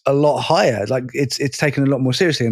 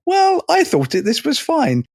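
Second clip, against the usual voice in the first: mocking mother voice; 13 semitones higher than usual.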